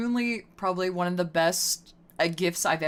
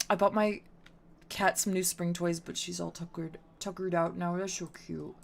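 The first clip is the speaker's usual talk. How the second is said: normal talking voice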